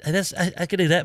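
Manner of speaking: normal talking voice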